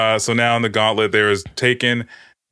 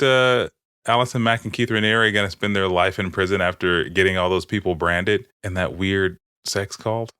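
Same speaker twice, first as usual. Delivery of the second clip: ordinary talk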